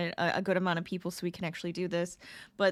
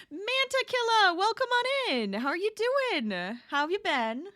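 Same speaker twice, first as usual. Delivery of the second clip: bright, much higher welcome to a viewer; higher, louder cheerful hello to a new arrival; excited greeting; 10 semitones higher, 5 dB louder than usual. Real, not acted. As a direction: pitch way up and sing out the hello.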